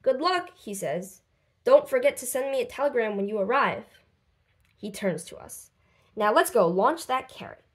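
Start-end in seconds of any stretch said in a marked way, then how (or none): none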